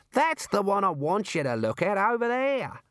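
high, insistent Australian kangaroo voice; exasperated Australian critter, high and lilting; insistent talking kangaroo. Acted. Figure of the kangaroo.

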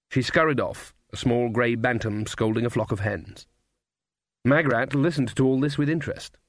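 usual speaking voice

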